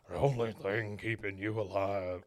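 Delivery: low, weighty film-character voice; Charlton Heston. Acted. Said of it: "I did the wrong voice".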